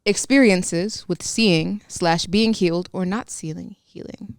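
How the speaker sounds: slow, careful reading aloud; slow deliberate read. Shown as a demonstration. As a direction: read the question slowly. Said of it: "I'll speak normally now".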